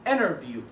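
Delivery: "انترفيو" as "innerview", T left out; silent T. Shown as a demonstration. Teacher repeating the rule's result, silent T. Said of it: "سايلنت تي".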